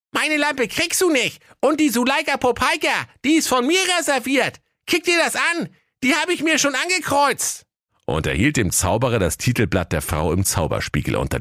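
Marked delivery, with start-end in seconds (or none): 0.1-7.7 put-on high cheeky boy voice; bratty teenage boy, much higher; cheeky young lad. Aladdin boasts.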